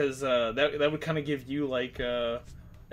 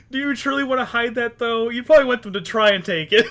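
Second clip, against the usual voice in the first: laughing; 10 semitones higher, 12 dB louder than usual. Real, not acted. Laughing while giving advice.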